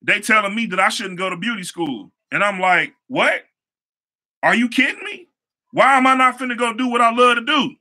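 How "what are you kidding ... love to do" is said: high, rising disbelief; can't believe what you're hearing; incredulous. Retold or imitated. To homeboys who doubt beauty school, high incredulous disbelief. Friends tell him to skip school.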